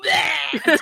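loud, hammed-up noise of disgust; mock retching "bleh". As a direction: blurt out a loud fake gag.

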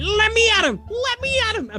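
high, eager little-dog yell; squeaky yap of a pup spoiling for a fight; Scrappy-Doo battle cry. Retold or imitated. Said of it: "It's like Scrappy-Doo".

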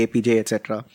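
ordinary delivery

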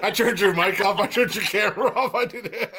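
high-pitched, laughing confession; laughing gleefully. Real, not acted. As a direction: crow about your prank through giggles. Laughing after cutting off co-host.

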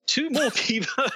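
put-on botched Aussie accent; failed Australian accent. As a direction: attempt Aussie and break into laughter. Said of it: "I can't do it".

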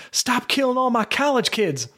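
high-pitched, indignant outburst; livid. Acted. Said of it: "Now he's livid".

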